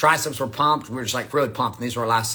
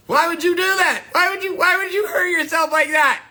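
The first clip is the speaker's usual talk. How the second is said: high, nasal-free whiny mock voice, much higher; whiny mocking skeptic; 11 semitones higher than usual. Mocking the fat guys who question his bulking, in a high whiny voice. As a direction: mimic a whining critic, pitched way up. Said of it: "some skinny fat dude or some fat dude talking shit".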